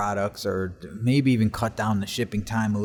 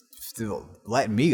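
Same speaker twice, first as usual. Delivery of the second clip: ordinary delivery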